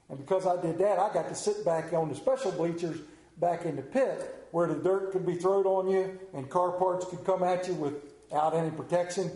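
normal talking voice

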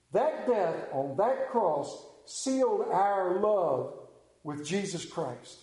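ordinary talk

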